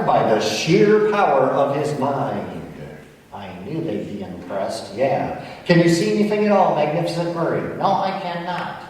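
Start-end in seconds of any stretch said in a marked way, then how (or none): 3.3-5.4 a soft, lightly voiced parrot puppet; macaw puppet voice. Ventriloquist's macaw answers the hypnotist, softer puppet voice. Murray smug aside. 7.8-8.8 a soft, lightly voiced parrot puppet; macaw puppet voice. Ventriloquist's macaw answers the hypnotist, softer puppet voice. Murray answers blindfold question.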